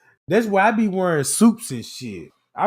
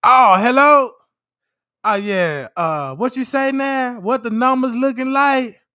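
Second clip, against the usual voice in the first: high, loud self-important phone voice; mock executive on a fake call; fake business call; 6 semitones higher, 5 dB louder than usual. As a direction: play the big shot on the phone. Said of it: "little fake call".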